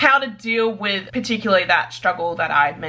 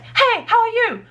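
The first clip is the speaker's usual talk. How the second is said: much higher chirpy greeting; cheery character voice; 13 semitones higher than usual. Acted. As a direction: play a bubbly character. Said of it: "having them talk like".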